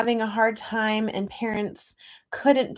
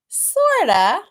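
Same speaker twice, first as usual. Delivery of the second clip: playful mom; 8 semitones higher, 8 dB louder than usual. Acted. Mama answers playfully.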